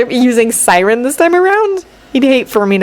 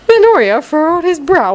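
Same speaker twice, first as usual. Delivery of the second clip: plain reading voice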